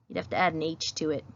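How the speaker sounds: ordinary talk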